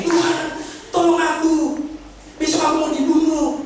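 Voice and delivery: high-pitched cry for help; hammed-up distress, voice pitched high; acted desperate plea. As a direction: plead for rescue as if in danger, play-acted. Acting desperate cry to God for help.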